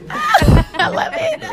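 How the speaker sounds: laughing. Real, not acted. Laughing at the accent.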